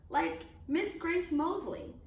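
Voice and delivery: ordinary delivery, nothing marked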